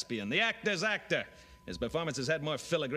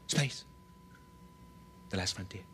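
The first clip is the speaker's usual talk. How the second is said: William Shatner; 6 semitones lower, 7 dB quieter than usual. Retold or imitated. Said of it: "William Shatner".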